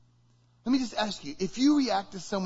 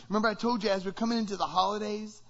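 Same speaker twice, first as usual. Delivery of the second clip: ordinary talk, nothing marked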